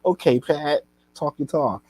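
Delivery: hyped at Pat's promo; impressed reaction. Retold or imitated. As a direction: jump back impressed. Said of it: "I was like".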